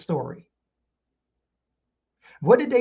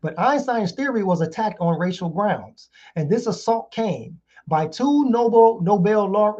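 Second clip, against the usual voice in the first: ordinary delivery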